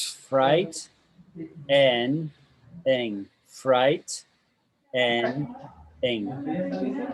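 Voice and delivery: fright, en, ing as three separate beats; broken into syllables. Shown as a demonstration. Teacher correcting a student's pronunciation, slow separate syllables "fright, en, ing". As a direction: spell out the sounds with clear pauses: fright... en... ing. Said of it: "I'll say it slowly".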